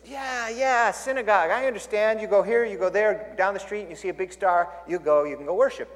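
put-on high, lively Chinese-accented English; thick Chinese accent. Pastor telling a joke, clerk in a thick Chinese accent. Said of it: "but with a really thick Chinese accent".

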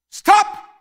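high loud order; dog command. Retold or imitated. Owner giving his dog an order, loud command.

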